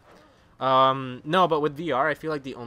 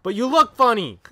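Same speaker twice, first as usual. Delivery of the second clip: high, loud mock yell; mock accusation; 10 semitones higher, 13 dB louder than usual. Imitating someone doubting the kid's English, loud and high. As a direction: blurt it out as a mocking objection. Mocked reply to the kid.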